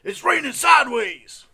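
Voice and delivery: very high, full-volume scream; screamed weatherman bit. Acted. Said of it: "he just screamed".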